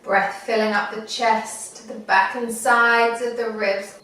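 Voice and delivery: unhurried meditation guide, long pauses; guided meditation. Acted. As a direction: talk them gently through it, phrase by phrase.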